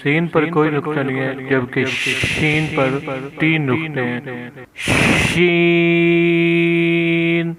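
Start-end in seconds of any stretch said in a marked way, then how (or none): 4.8-7.5 سین held very long with a whistling hiss. Shown as a demonstration. Teacher showing pupils the whistling letter, slow. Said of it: "سین اور شین کی بناوٹ ایک جیسی ہے".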